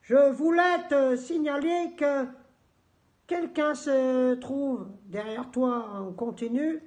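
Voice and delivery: slow, high, loud put-on formality; mock-solemn letter. Answering Lutzi's message as a joke, mock-solemn. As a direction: declaim it slowly, as a joke warning. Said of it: "Non, je déconne".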